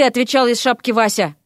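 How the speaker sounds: ordinary delivery, nothing marked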